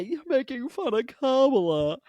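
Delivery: high, put-on whiny objection; high mocking protest; mock complainers. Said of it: "people who are gonna say".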